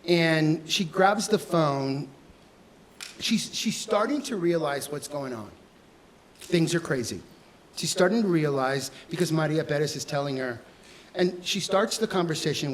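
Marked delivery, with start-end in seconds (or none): none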